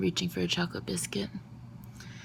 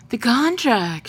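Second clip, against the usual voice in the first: loud, high cry of alarm over the papers; annoyed shout; 6 semitones higher, 9 dB louder, slower than usual. Acted. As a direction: yell it as the coffee spills. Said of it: "shouts Mister Chiseled in annoyance".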